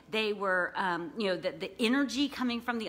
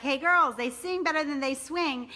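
a touch of the shout, higher and a little louder; mild retold shout; 6 semitones higher, 3 dB louder than usual. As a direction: replay the call across the field lightly. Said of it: "when I said that".

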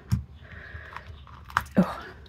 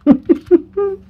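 high giggle; 13 semitones higher, 6 dB louder than usual. Real, not acted. Laughs after whisper.

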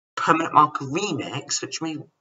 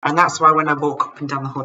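ordinary delivery

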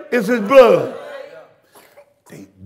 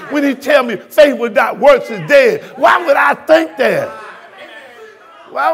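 shouted, rising sermon cadence; fiery preacher; preaching hard; 7 semitones higher, 6 dB louder than usual. Real, not acted. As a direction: hammer each scripture at them. Preaching at full force.

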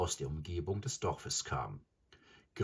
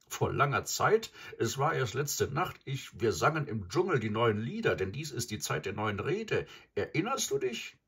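high, lifted storybook wolf voice, protesting; indignant wolf companion; 6 semitones higher, 4 dB louder than usual. A narrator's reading. Graubruder answers Mowgli's reproach, indignant and high. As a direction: protest in a raised, lively voice.

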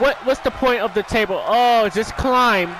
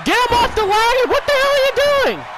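outraged yell; 12 semitones higher than usual. Real, not acted. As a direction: scream at them to get him off the ladder. Screaming at wrestlers on screen.

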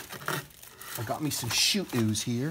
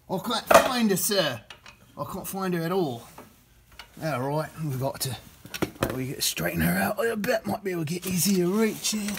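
higher-pitched, hammed-up Australian matey voice; terrible Australian accent; 6 semitones higher than usual. Mechanic narrating a fiddly bolt job, bad mock Australian accent. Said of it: "for my terrible Australian accent".

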